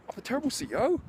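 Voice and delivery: high, incredulous echo of "terrible C E O"; repeating an insult in high disbelief; outraged echo. Retold or imitated.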